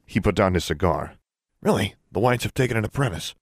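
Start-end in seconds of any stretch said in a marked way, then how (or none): 1.6-3.3 surprised, higher-pitched question; intrigued shopkeeper, pitched up; abruptly changed demeanor. A narrator's reading. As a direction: react with sudden keen interest. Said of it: "The wizard's demeanor changed abruptly".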